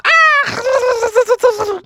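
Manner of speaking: very high open-mouthed "ah"; cartoonish high gulping cry; chomping cry. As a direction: squeal as if gobbling something whole. Bucky chomps the ball.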